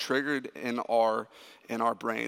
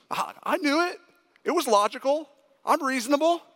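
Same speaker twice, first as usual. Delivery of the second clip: much higher, straining to sound reasonable; high-pitched self-defence; 11 semitones higher, 3 dB louder, faster than usual. Retold or imitated. Dad insisting he knew the movie's ending, high-pitched protest. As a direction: plead in a very high voice.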